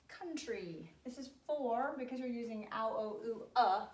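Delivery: ordinary talk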